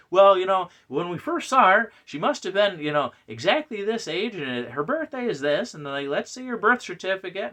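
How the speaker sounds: high, loud fussy insistence; mock nitpicking fan. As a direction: rattle off demands as an obsessive pedant. Parodies obsessive continuity nitpicking.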